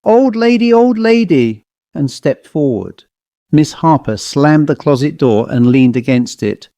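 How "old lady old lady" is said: quacking doll voice. A narrator's reading. Doll advances on the old lady, high quacking voice. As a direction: call it high like an uncanny toy. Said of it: "The doll said".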